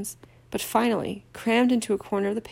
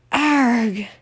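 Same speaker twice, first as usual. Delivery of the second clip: sudden startled cry; startled yell; 3 semitones higher, 7 dB louder than usual. A narrator's reading. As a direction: yell out in shock. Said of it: "Ron had let out a yell".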